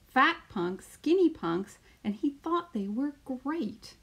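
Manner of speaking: put-on lively storytelling voice for children; picture book read-aloud. Teacher reading a picture book to her class, lively storytelling. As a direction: read it out like story time on the mat.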